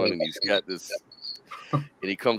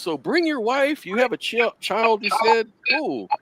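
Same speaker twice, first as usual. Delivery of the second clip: much higher mock boss voice; pitched-up impression of a friendly boss; imitating the boss; 11 semitones higher than usual. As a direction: play the boss high and chummy. Boss asks about his family.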